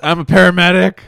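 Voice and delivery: loud, laughing, deadpan-shock delivery; shocking stage bit. Retold or imitated. The paramedic on stage shocking the crowd, loud and laughing. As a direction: blurt out an outrageous line for shock. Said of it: "he was just like".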